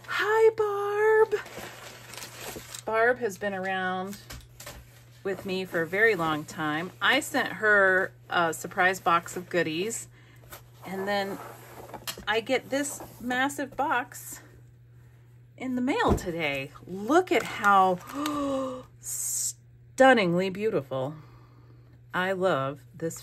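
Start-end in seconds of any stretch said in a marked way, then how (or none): 0.1-1.3 very high, drawn-out hello; high singsong greeting. Real, not acted. Vlogger greets friend who sent a box, high excited greeting. 18.0-18.8 soft gasp of wonder; awed gasp. Real, not acted. Vlogger admires friend's wrapped box, awed gasp.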